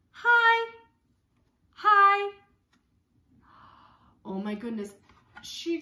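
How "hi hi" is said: high, loud happy hi; cheery child greeting. Acted. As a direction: wave hello from the sky. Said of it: "and says".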